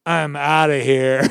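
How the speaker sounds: slow, loud mock toddler declaration; a loud toddler announcing he's leaving; toddler quote. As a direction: stamp it out like a defiant two-year-old. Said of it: "He goes".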